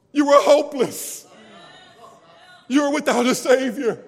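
loud climbing preacher cadence; telling the church who they were; preaching. Acted. Preaching at full force.

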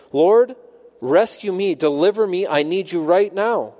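loud, high pleading to the Lord; a man begging God to save him, crying out loud; desperate cry. Acted. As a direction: cry out for rescue at full voice. Preacher voicing David's cry.

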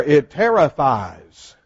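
slow, loud "terrifies"; emphatic. Shown as a demonstration. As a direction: lean hard on "terrifies", slowly. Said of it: "where did you get ter-, terrible?".

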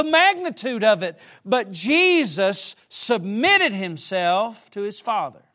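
high, loud impassioned preaching; preaching at full force. Acted. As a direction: build it up and pound it home. Preaching climax on the mother.

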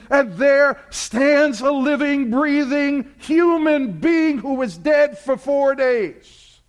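high, loud, building sermon delivery; impassioned preaching. Acted. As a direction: paint the scene with rising fervor. Preaching climax.

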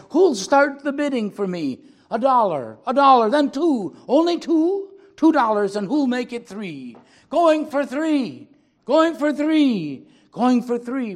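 loud, high auctioneer chant, slowing on the last calls; auctioneer calling bids. Acted. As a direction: rattle off the bids like a real auctioneer. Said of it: "he cried".

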